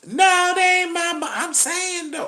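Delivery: high, slow, flustered woman impression; high slow mock woman. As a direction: stumble it out high and defensive. Woman voiced in dialogue.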